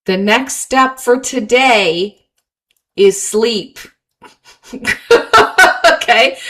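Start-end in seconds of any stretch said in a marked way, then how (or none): 4.7-6.0 laugh. Real, not acted. Laughs at own advice.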